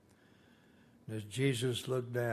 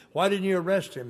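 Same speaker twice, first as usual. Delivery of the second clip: angry demand; 7 semitones higher, 7 dB louder, faster than usual. Retold or imitated. Leaders scolding returning soldiers.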